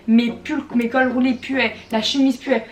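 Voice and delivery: ordinary talk, nothing marked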